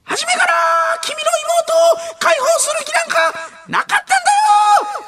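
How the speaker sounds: very high, loud hammed-up yell; angry yell. Impersonating a comedian yelling a drama betrayal line, angry high shout. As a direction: bellow it like an enraged drama villain. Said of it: "浜田さんの行ったことない隣".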